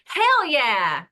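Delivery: loud, punchy "Hell yeah!"; kid's excited exclamation. Retold or imitated. Son shouting mid-story, a loud cheeky cheer. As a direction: blurt it out like a cheeky nine-year-old. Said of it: "he goes".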